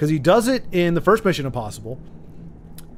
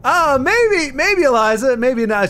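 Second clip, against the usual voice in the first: playful teasing; 8 semitones higher, 6 dB louder, slower than usual. Real, not acted. Teasing a chat member.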